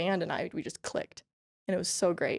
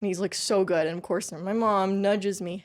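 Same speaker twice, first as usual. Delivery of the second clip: ordinary talk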